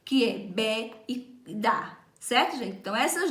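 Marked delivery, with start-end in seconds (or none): 0.0-2.0 bé and dá spoken slowly and apart; stressed syllables bé and dá. Shown as a demonstration. Teacher to class, slowly stressing bé and dá. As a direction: lean on bé and dá, drawn out. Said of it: "se sobrepõem duas sílabas aí forte".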